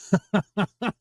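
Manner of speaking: brief high chuckle in a few bursts; short chuckle. Real, not acted. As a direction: chuckle at your own remark. Joking about Smyslov's near miss.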